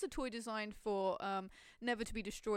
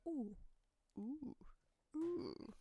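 toy bear noise; 2 semitones higher, 7 dB quieter, much slower than usual. Retold or imitated. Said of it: "he just makes a noise going".

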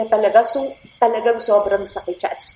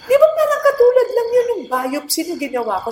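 quoting surgeon; 12 semitones higher than usual. Retold or imitated. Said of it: "sabi ng breast surgeon ko".

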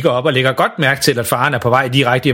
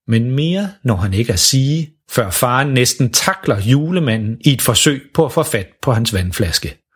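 ordinary delivery, nothing marked

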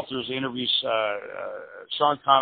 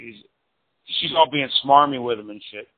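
ordinary delivery

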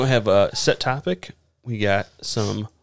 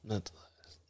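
whisper; 19 dB quieter than usual. Acted. Echoing partner ominously.

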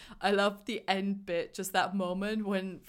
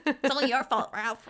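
louder, higher, accusing little voice; resentful Gene; 5 semitones higher, 4 dB louder than usual. Retold or imitated. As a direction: blame him with a whiny, high sting. Quoting Gene's resentful line.

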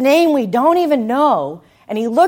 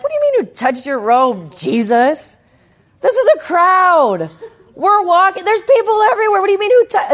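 much higher and louder, incredulous; exasperated disciples; 8 semitones higher, 4 dB louder than usual. Retold or imitated. Disciples to Jesus, incredulous and loud. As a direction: scoff like baffled disciples in a crowd. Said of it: "what do they say? They're like".

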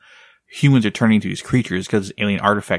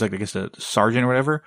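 ordinary talk

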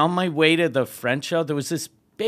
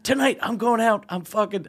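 higher-pitched mock celebration; giddy, raised-pitch party announcement; elated retold passenger; 6 semitones higher than usual. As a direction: imitate a man thrilled to be going out. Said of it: "he's fucking so happy".

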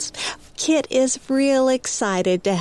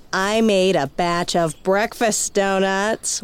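ordinary delivery

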